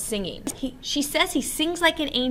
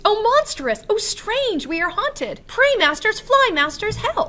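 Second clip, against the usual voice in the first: high, loud panicked cries for help; frightened scream; 10 semitones higher, 6 dB louder than usual. Acted. Quince flees transformed Bottom, high, loud panicked screaming. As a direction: shriek it like a terrified actor fleeing a monster. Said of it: "Quince screams".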